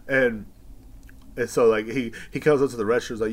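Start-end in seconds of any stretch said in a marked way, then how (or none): none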